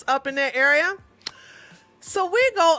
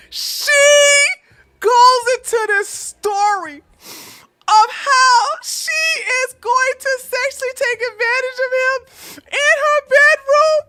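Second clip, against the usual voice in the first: much higher and louder, laughing through the words; helpless laughter; 13 semitones higher, 7 dB louder than usual. Real, not acted. Teller losing it over her friend's come-on, laughing hard. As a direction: crack up while trying to talk. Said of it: "'Cause I'm laughing most of the time".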